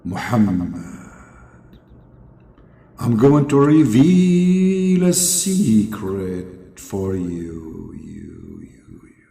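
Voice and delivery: drawn-out godly voice with echo; very slow, solemn sound-effect voice; Allah. Acted. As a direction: boom it slowly, fading into echoes. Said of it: "voice call between Allah and Muhammad needs some".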